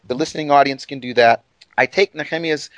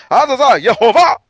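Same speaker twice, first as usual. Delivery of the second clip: loud, high, laughing cry of praise; imitating her joyful shouted praise; 7 semitones higher, 8 dB louder than usual. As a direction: shout the name out with joy. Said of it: "She would shout it".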